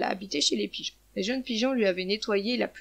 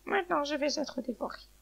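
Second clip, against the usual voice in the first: higher, softened hen voice; lightly voiced hen, pitched up; hen's thought; 5 semitones higher, 4 dB quieter than usual. A narrator's reading. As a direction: think it aloud as the little hen. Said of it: "La poule l'entendit et pensa".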